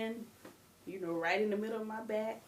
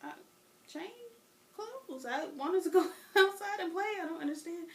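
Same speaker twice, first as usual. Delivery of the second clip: put-on higher, bewildered little-girl voice; innocent kid; 9 semitones higher than usual. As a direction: explain it like a puzzled child. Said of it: "I'm like".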